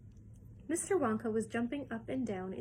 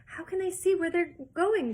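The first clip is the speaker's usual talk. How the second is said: high, loud frightened shriek; a hint of a scared girl; 8 semitones higher, 8 dB louder than usual. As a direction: shriek into the dark. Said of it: "shrieked Violet Beauregarde".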